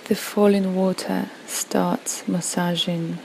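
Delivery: unhurried relaxation voice, phrase by phrase; guided meditation. Acted. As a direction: gently guide a listener through a peaceful visualization. Leads a guided visualization.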